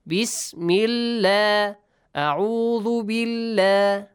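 Bismillah, A'udhu billah with a light "la", chanted slowly; light L after kasra. Shown as a demonstration. Teacher modelling the rule after a kasra, light L. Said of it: "you pronounce it with an a sound".

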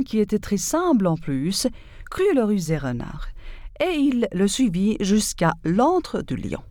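plain reading voice

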